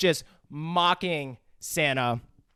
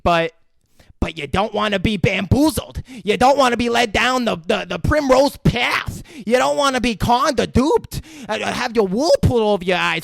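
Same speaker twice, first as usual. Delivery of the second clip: menacing hobo rant; 6 semitones higher, 4 dB louder than usual. Retold or imitated. Hobo lecturing kid.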